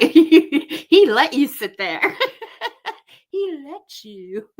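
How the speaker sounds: high, slow words through laughter; laughing. Real, not acted. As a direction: giggle through the words, drawing them out. Teasing over who sits on sofa.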